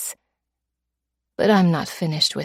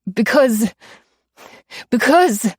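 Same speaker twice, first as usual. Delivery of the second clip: stammering fear; 5 semitones higher, 5 dB louder than usual. A narrator's reading. Terrified mugger stammering.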